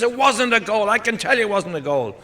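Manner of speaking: high, heated, insistent denial; mock-outraged dispute with the ref; arguing. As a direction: protest harder, pitched right up and punchy. Acted argument with the ref.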